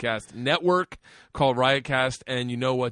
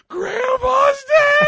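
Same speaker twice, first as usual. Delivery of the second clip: much higher, louder, slow sobbing shout; child's wailing cry; 14 dB louder, much slower than usual. Retold or imitated. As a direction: wail it like a heartbroken little girl. Daughter wailing at news.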